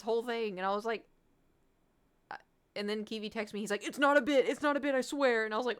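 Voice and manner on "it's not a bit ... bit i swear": loud, high-pitched protest; urgent insistence. Retold or imitated. Friend texting that it's genuine, urgent insistence. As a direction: insist at raised pitch and volume.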